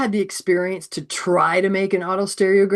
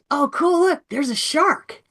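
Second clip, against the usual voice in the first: put-on higher, delighted kid pointing it out; eager kid; 7 semitones higher, slower than usual. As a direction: play a thrilled student spotting it. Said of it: "a student in your class goes".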